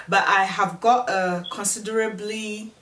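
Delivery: ordinary talk, nothing marked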